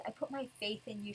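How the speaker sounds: hushed mock seething. Di Maria watches her friend from afar, seething. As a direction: quietly imitate contained fury. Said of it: "seething, she's shaking, she's so mad".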